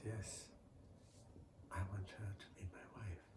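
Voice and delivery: fast breathless whisper; whispered wish. Acted. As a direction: confide it under your breath, quickly. Frog secretly declares wish.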